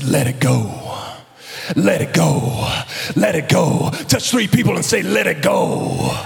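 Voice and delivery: deep, rising preacher's call; low booming preacher repeating the line; T D Jakes impression. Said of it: "He said".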